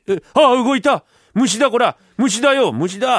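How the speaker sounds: loud, high alarmed yelling; panicked shout. Acted. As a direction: scream that it moved. Villagers panic as bun moves.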